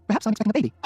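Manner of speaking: high-pitched coy announcement; teasing wife. Acted. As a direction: drop the tease in a high voice. Wife teases husband.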